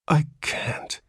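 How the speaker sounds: whispered, despairing moan; horrified father moans, half whispered. A narrator's reading. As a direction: moan it in hopeless dread. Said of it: "he moaned".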